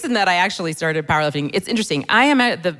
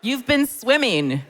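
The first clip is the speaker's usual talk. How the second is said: cheery encouragement; 6 semitones higher than usual. Retold or imitated. Encouraging kids, higher.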